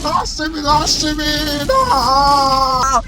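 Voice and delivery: panicked pleading. Pretend. Pleading to be let go.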